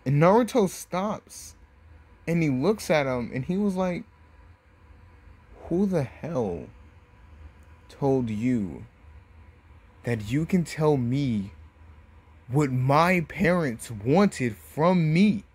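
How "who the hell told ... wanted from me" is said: slow, emphatic acted outrage; measured indignation swelling into a loud demand; angry confrontation. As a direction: space out each phrase, building louder to the end. Naruto confronts Gaara about his parents.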